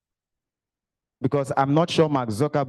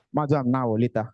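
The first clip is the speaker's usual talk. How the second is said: ordinary talk, nothing marked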